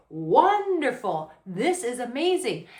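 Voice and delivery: high, drawn-out hammed-up delight; delighted gushing. Woman turned on by her man, gushing with delight. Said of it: "really turned on to where she's like".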